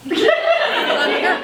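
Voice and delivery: high, drawn-out, showy announcement; hammed-up boast. Party consultant announcing herself to guests, hammed-up excitement. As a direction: stretch it out way up high, proudly. Repeats her boast, hamming it up.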